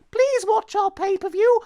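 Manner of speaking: high squeaky mock plea; mock high voice of the company begging; mock pleading. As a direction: squeak it like a desperate ad. Mock quote of WWE plea.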